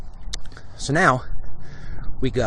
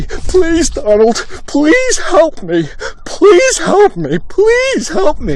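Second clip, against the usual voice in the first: high, slow, whiny pleading for help; pitiful high whimpering plea; mock begging Baldwin; 13 semitones higher, much slower than usual. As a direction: ham up a tough guy begging to be saved. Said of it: "big tough guy".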